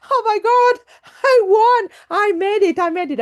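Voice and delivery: high, loud cry of victory; thrilled triumph. Retold or imitated. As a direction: relive the win, bursting with joy. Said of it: "I was so excited".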